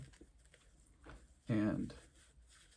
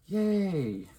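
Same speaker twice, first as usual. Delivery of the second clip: high, loud, drawn-out cheer; happy cheer; 8 semitones higher, 5 dB louder than usual. Real, not acted. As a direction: cheer your own little victory. Cheering own success.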